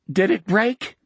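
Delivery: high, panicky question; voice cracking. Acted. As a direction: voice cracking toward a scream. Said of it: "his voice cracked toward a scream".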